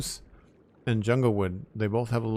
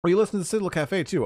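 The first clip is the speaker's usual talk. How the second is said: delighted surprise; 10 semitones higher, 6 dB louder, faster than usual. Real, not acted. Delighted a viewer knows the podcast.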